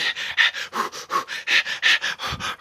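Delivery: loud, quick huffs in and out; hammed-up hyperventilating; mock panicked panting. Pretend patient told to breathe, panicky panting. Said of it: "like, panicking and shit".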